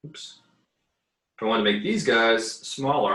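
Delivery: usual speaking voice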